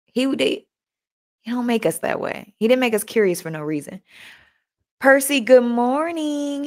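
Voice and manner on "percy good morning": slow, high, sing-song hello; drawn-out greeting. Real, not acted. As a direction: stretch the greeting out warmly. Greeting a viewer on live.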